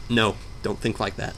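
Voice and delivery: ordinary talk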